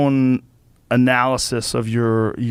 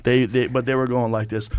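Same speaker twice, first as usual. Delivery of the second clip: ordinary delivery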